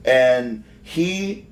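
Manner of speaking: ordinary delivery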